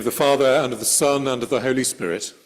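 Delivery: solemn church invocation; liturgical invocation. Acted. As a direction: open with the words of the blessing. Opening invocation before sermon.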